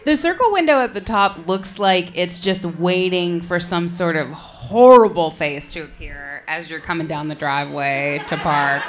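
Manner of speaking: ordinary talk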